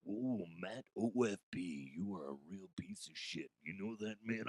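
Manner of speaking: soft, low gravel of a Macho Man whisper; a hint of Macho Man, hushed and low; Macho Man impression. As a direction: creep in as a menacing wrestler. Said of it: "Macho Man likes to watch".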